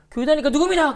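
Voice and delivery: loud demanding question; interrogating. Retold or imitated. Angry boy grilling a classmate about church, loud.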